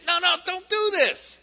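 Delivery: high, pleading protest; hammed-up pleading man; mock protest. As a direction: beg them to stop, half-joking. Man protesting being carried.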